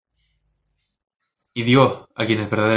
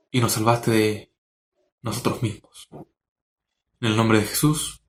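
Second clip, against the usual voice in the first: lightly voiced, earnest prayer to the Lord; spoken prayer. Preacher praying to the Lord for his congregation, earnest and sincere.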